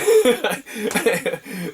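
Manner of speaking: a loud laugh at the memory; loud high laugh. Real, not acted. Laughing at own story.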